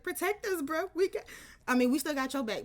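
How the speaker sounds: high, loud, fired up; hyped-up [name] voice. Retold or imitated. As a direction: bark it back protectively. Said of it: "it's like".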